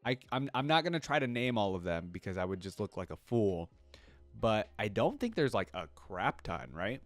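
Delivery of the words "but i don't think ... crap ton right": normal talking voice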